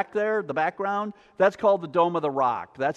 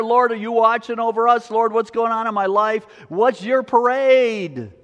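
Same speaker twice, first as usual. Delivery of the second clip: higher, louder plea to the Lord; urgent prayer; 5 semitones higher, 4 dB louder than usual. Acted. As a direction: call out to God, drawing out the final question. Acted urgent prayer to God.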